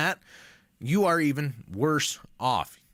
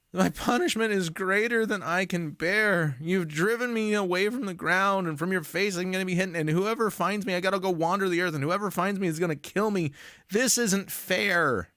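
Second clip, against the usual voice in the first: higher, whiny self-pitying complaint; pouting pity voice; 5 semitones higher than usual. Shown as a demonstration. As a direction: sulk through it like a sorry-for-himself victim. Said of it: "read this in kind of that pouting, like, pity voice".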